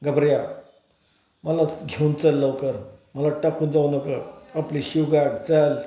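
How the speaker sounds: plain reading voice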